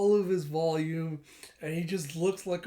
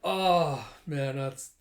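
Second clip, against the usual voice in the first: usual speaking voice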